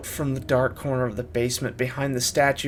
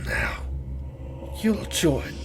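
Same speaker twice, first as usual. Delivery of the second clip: demonic threat; 12 semitones lower, 5 dB quieter than usual. Acted. Demonic Eileen threatens captive.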